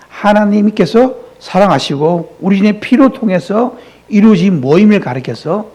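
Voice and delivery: ordinary talk, nothing marked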